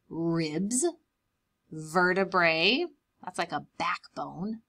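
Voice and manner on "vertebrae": usual speaking voice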